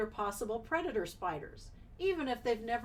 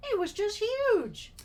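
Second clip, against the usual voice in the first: quoted woman voice; 12 semitones higher, 8 dB louder than usual. Pretend. Reading quote in a character voice.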